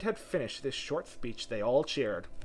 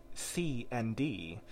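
usual speaking voice

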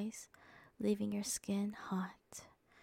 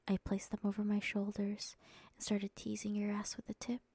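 plain reading voice

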